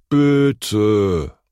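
loud, drawn-out plea; wheedling, stretched-out pleading; begging. A narrator's reading. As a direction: beg like a puppy-eyed child. Boy begs teacher with puppy-dog look.

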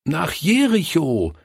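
high-pitched chorus-like reply; children calling out. A narrator's reading. Children remind the pastor of the town, calling out. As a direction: call the answer out like children. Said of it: "riefen sie".

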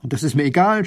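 loud, annoyed outburst; cross ruler cutting in; scolding. A narrator's reading. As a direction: brush off an objection crossly. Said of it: "schimpft der Großherzog".

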